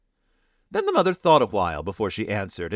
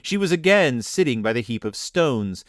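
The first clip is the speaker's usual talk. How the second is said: ordinary delivery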